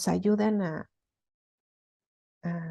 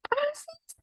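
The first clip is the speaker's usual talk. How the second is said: high, light voice of a husband who agrees; an easy, carefree agreeing husband; much faster than usual. Retold or imitated. Husband agrees calmly once she speaks kindly, lightly voiced.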